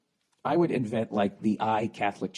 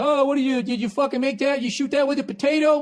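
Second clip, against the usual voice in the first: high, loud scoffing skeptic voice; mocking doubter; 13 semitones higher, 5 dB louder than usual. Twitter doubters scoffing at hologram Jesus, high mocking voice. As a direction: sneer at the hologram like an internet doubter.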